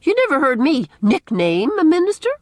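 high, loud indignant question; affronted protest. A narrator's reading. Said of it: "protested Missus Lynde".

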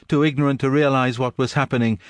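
ordinary delivery, nothing marked

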